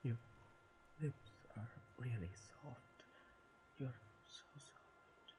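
slow, soft breathy wonder; tender whisper. Acted. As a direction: whisper it in awe after a kiss. After the kiss.

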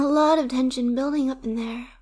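usual speaking voice

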